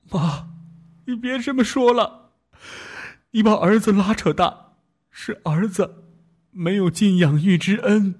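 high, choked tearful pleading; sobbing speech. Acted. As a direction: speak through tears to mother. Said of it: "泣不成声地说".

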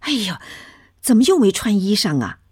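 high, loud, quick exclamation of dismay; fussy alarm. A narrator's reading. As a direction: make a big fuss, pitch jumping up. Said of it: "大惊小怪地说".

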